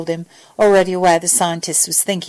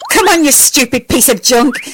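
louder, higher exasperated scolding; 5 semitones higher, 5 dB louder than usual. A narrator's reading. Al scolds the faulty hand link, exasperated. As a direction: berate it in exasperation. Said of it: "he said, exasperated".

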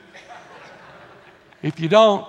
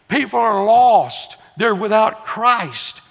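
loud, slow, weighty pulpit declaration; fervent preaching; 5 semitones higher, 7 dB louder, slower than usual. Real, not acted. Preacher declares the world's need, loud fervent preaching. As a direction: hammer each phrase out slowly and higher.

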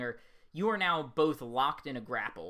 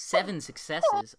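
slow, loud disbelief; surprised; 4 semitones higher, 6 dB louder than usual. Real, not acted. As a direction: repeat it in shock. GM reacts to big roll.

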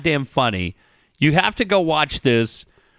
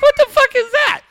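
much higher, louder stunned outburst; 6 dB louder than usual. Retold or imitated. Watching the video with Steve, a stunned outburst. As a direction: blurt it out in shock, way up high. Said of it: "I was like".

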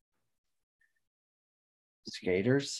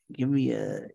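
usual speaking voice

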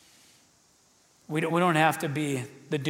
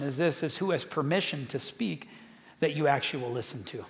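usual speaking voice

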